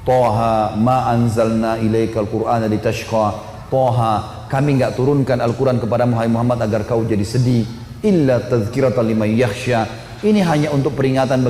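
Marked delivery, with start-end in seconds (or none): 0.0-9.9 low, measured reading of scripture; solemn scripture reading with its translation; Qur'an recitation. Acted. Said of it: "Begitu baca surah Toha".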